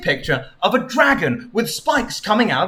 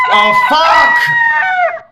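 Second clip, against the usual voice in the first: startled shout; 8 dB louder than usual. Real, not acted. Startled by a donation alert.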